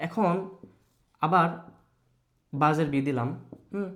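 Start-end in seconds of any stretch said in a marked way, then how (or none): none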